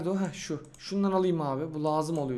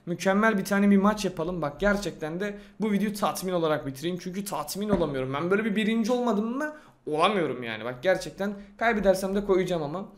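ordinary delivery

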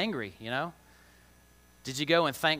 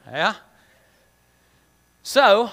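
ordinary talk

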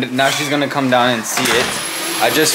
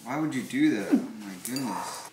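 soft, lowered grumble; quiet, low exasperation; deflated dismay; 5 semitones lower, 11 dB quieter than usual. Real, not acted. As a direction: let the protest sink to a quiet complaint. Same complaint, deflated after outburst.

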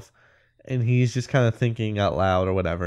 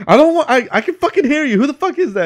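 much higher, louder panicked yelling; 6 dB louder, faster than usual. Retold or imitated. Marty yells back at the whispering voice, panicked and much higher and louder. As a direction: scream it like a spooked stoner losing it. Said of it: "He's, like, freaking out".

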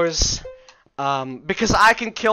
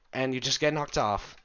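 ordinary talk

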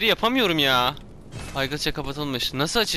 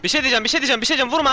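higher, urgent repeated plea; raised, harried voice; flustered pleading; 8 semitones higher, 5 dB louder, much faster than usual. Real, not acted. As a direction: beg an attacker to hold off. Pleading while being hit in game.

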